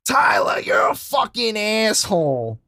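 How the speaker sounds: mock furious yell at him, high; retold yell. Girlfriend yelling at her beer-spraying boyfriend, mock furious yell. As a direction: shout it like the angry girlfriend. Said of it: "She's like".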